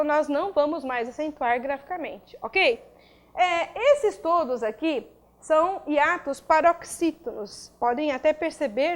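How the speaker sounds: ordinary delivery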